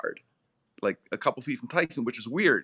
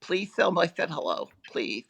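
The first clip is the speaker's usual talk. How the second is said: a light, emotional imitation of the boxer, close to his own voice; Mike Tyson impression; 6 semitones higher, 3 dB louder than usual. Guest quoting Tyson's tearful bear-hug plea, a light emotional imitation. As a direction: play a choked-up champ, only lightly voiced. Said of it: "your Tyson impression's right on".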